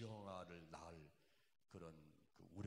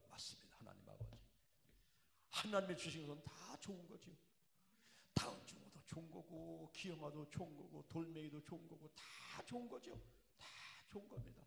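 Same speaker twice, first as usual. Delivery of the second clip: fervent prayer; 8 semitones higher, 4 dB louder than usual. Retold or imitated. Retold prayer of surrender.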